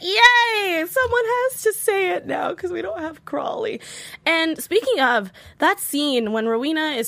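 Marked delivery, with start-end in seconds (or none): 0.0-3.8 high squealing delight; giddy fangirl voice; excited cheer. Retold or imitated. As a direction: squeal like a fan who got her wish. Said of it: "And I was like".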